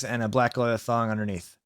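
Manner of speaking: plain reading voice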